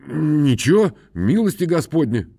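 high humble peasant voice. A narrator's reading. Khromoy answers. (высокий робкий голос мужика)

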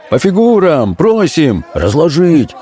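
high-pitched clamouring demand; crowd voices. A narrator's reading. Players backing the officer's demand, calling out. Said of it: "снова раздались голоса".

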